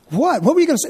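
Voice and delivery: high, curious mock questioning; imitating prying wife. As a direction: play a wife pressing to be told. Acting wife pressing him to tell.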